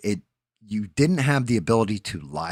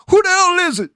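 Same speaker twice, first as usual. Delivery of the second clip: very high, very loud hammed-up yell at the door; Mister Robinson yell; 14 dB louder, faster than usual. Yelling at a visitor through the door, in a shrieking mock voice. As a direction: scream it at whoever's knocking. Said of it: "That was Mister Robinson's".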